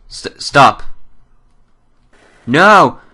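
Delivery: high, loud panicked no; startled cry. Real, not acted. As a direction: shriek it out as the scare hits. Jump scare in horror game.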